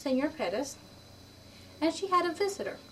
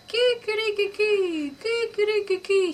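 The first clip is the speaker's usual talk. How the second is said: loud, high, slow rooster crowing; 10 semitones higher, 7 dB louder than usual. Acted. Old rooster crowing his proposal, loud and high. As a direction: crow it out like a proud old cock. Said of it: "I will talk to you like this".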